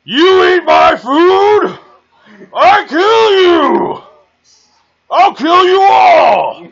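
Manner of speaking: high, loud, slow furious bellow; raging shout. Acted. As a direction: roar it out in a berserk rage. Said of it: "he just starts going".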